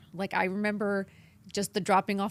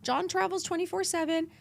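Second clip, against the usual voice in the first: panicked disbelief; 8 semitones higher than usual. Retold or imitated. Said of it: "I was like".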